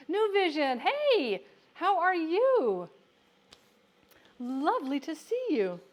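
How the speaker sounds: high, loud welcome to a viewer; light up and greet them; excited greeting. Real, not acted. Greeting a viewer who joined.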